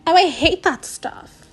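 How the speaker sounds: disgusted. Acted. Retake, higher louder.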